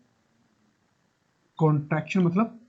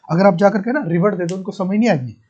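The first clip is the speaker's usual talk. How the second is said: ordinary talk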